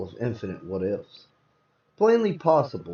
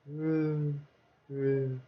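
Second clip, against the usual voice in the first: fading murmur; 8 dB quieter than usual. Acted. Drifting under sedation.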